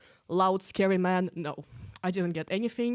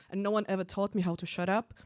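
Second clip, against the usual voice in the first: ordinary talk, nothing marked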